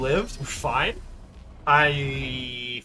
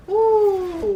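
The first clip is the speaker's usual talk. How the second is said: high, loud cheer; excited whoop; 12 dB louder than usual. Real, not acted. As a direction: whoop with relief. Unit survives the fight.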